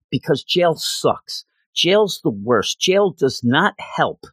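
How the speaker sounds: each word of jail sucks spelled out slowly; slow, emphatic lawyer. Retold or imitated. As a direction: hammer home that jail is the worst. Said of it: "Kirsten's like".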